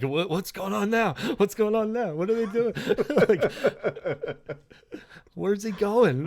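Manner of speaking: high, laughing frantic questions; mock frantic. Fan refreshing news feeds, laughing mock panic. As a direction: play the obsessed fan.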